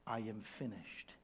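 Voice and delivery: low, very soft quotation; hushed. Acted. Preacher quotes what Jesus never said, hushed. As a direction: drop the voice for the quote.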